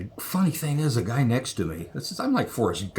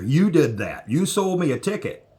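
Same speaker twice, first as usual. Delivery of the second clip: higher, louder indignant retort; retold indignant accusation; 7 semitones higher, 5 dB louder than usual. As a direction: throw the accusation back, voice climbing. Retold argument with the official.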